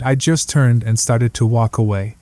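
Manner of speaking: usual speaking voice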